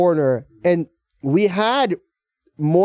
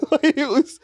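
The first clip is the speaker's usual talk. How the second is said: ordinary talk, nothing marked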